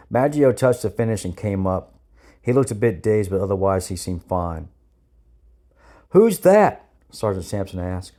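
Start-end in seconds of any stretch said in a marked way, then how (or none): 6.1-6.9 high, loud sergeant's query; called-out question. A narrator's reading. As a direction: shout the question across the pool.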